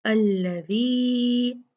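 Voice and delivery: अल्लज़ी slow with a long held ला; slow al-laa-zi; four-count stretch. Shown as a demonstration. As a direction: hold the ला in अल्लज़ी for four beats. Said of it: "इसको चार count खींचेंगे".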